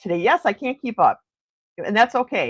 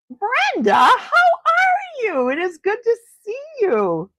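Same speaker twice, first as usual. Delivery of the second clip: high, drawn-out happy call; real delighted greeting; 3 dB louder, slower than usual. Real, not acted. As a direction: sing out a thrilled hello, stretched and high. Greeting a friend who just arrived.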